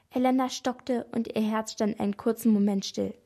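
ordinary delivery, nothing marked

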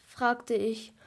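plain reading voice

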